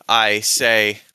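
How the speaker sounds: normal talking voice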